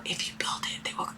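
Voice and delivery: hushed movie-voice whisper; near-silent whispered quote. Retold or imitated. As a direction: breathe it like the ghostly voice in the cornfield. Repeating the movie line whispered.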